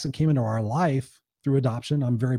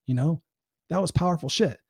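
ordinary talk

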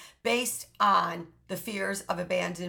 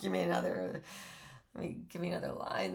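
whispery rat voice; 3 semitones lower, 9 dB quieter, faster than usual. Retold or imitated. Voicing the coked-up rat.

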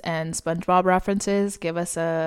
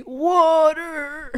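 dying last word; 8 semitones higher than usual. Pretend. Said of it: "my last word's gonna be".

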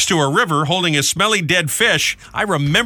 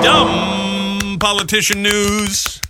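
raised, very drawn-out segment title; announcer sign-off; 5 semitones higher, much slower than usual. Pretend. Host closing the segment, slow drawn-out announcer call. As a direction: stretch it out like a jingle.

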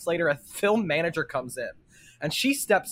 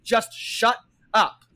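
higher, drawn-out mock scolding; retold full-voice snap; 5 semitones higher, slower than usual. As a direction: imitate a fed-up moviegoer, only mildly raised.

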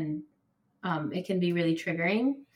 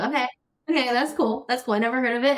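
higher, louder startled delight; surprised; 5 semitones higher, 6 dB louder, faster than usual. Retold or imitated. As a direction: react amazed at the news. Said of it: "I was like".

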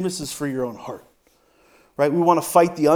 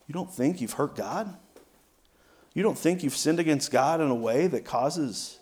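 ordinary talk, nothing marked

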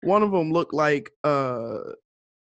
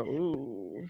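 softer and slow, a wary hesitation; wary aside; 2 semitones lower, 10 dB quieter, much slower than usual. Retold or imitated. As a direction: hold back, unsure, slowly. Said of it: "I go".

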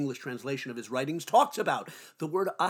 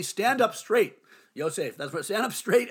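louder and higher, a firm nudge; wife's firm reminder; 4 semitones higher, 5 dB louder than usual. Retold or imitated. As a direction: call it out like a wife reminding. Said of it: "my wife reminds me".

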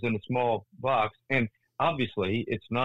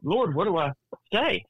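higher, urgent plea to God; pitched-up frantic cry for help; desperate prayer; 7 semitones higher than usual. Retold or imitated. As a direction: beg the Lord for words, rising in pitch. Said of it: "I'm just".

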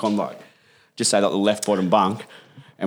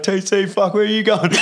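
retold calling out; 8 semitones higher than usual. Retold bouncer calling after him.

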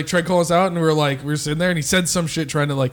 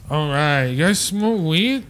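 lightly voiced stoner, drawn-out question; imitated stoner; much slower than usual. Comic mimics an audience member asking about weed, imitated stoner. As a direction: imitate a slow, spaced-out stoner.

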